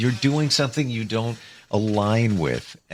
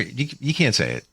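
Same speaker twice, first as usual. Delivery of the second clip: ordinary talk, nothing marked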